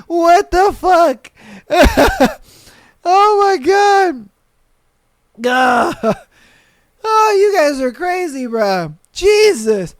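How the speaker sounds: high, loud laughing exclamations. Real, not acted. Streamer reacting to a viewer story, high and laughing. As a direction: laugh through every word, voice up high.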